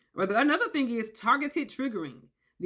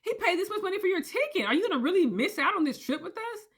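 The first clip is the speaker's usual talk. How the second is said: pushy friends; 9 semitones higher than usual. Retold or imitated. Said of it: "It's like".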